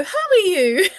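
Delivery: imitating a child's exact echo, high and laughing. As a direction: parrot it back like a small child. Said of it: "And they go".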